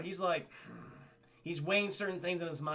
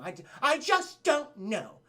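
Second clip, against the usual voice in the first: high, slow put-on princess voice; louder, drawn-out royal fluster; 7 semitones higher, 5 dB louder, slower than usual. Princess answering the Captain of the Guard, flustered. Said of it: "And Princess is like".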